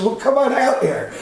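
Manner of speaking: high-pitched retold beckoning, breaking into laughter after; amused reenactment of calling someone out. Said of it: "I says".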